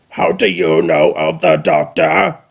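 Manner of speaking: low, slow grating robot; Dalek voice. Acted. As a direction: interrogate like a machine. Dalek interrogates.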